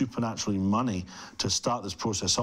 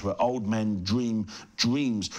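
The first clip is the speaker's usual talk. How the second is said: normal talking voice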